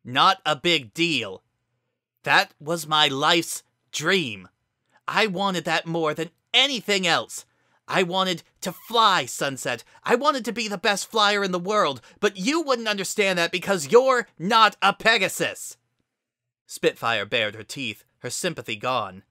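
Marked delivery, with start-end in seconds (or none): 0.0-4.5 loud, slow furious outburst; angry outburst. A narrator's reading. Argument erupts. 5.1-15.8 angry shouting. A narrator's reading. Heated argument.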